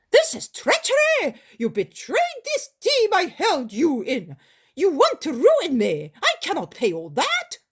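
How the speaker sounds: high, loud cry of betrayal; outraged shout. Retold or imitated. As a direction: yell as if cheated out of your fortune. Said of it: "he shouted".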